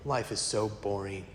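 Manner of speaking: low, soft bored-kid imitation. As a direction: sound utterly uninterested, imitating a listless kid.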